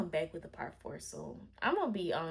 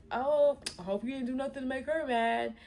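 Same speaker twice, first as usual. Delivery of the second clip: lightly voiced joking dad, higher-pitched; retold joking dad; 5 semitones higher than usual. Boyfriend's dad joking about her being mad, teasing. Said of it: "even made a joke like".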